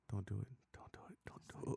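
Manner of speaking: hushed, breathy self-warning under his breath; whispering to himself. Real, not acted. Holding back his own plug line, whispered self-warning. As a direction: whisper it to stop yourself. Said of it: "I was trying not to do it myself".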